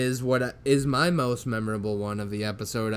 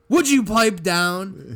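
slow, loud snap; loud scolding; 6 semitones higher, 6 dB louder, slower than usual. Retold or imitated. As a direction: tell them off loudly. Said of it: "he's just like".

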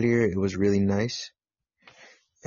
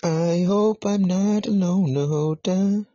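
higher, louder and slow, drawn-out plea; mock-plaintive whine; 9 semitones higher, 4 dB louder than usual. Real, not acted. Host waiting in an empty chat, mock-plaintive drawn-out whine. Repeats line slower and higher.

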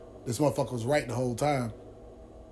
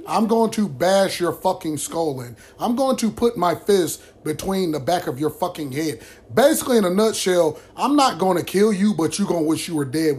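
furious threat; 3 semitones higher, 4 dB louder than usual. Real, not acted. Threatening the brother on camera.

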